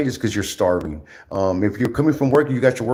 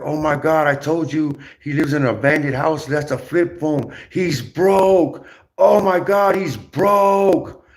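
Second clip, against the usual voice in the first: high, loud mocking gasp over a flip phone; mocking the viewers who call him broke, high and loud; mocking gossipers; 5 semitones higher, 3 dB louder, slower than usual. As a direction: play the shocked gossip, voice climbing. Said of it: "They were like".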